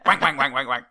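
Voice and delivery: loud put-on quacking repetition; mock cartoonish chant; comic impression. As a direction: rattle it out in a silly loud voice. Riffing on the impression.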